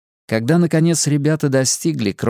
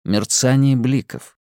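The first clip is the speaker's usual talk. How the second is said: plain reading voice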